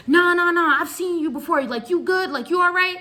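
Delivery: high, loud, pushy insistence; insisting loudly. Retold or imitated. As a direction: press her, sure you know her, loud and high. Homeless man insists he knows her.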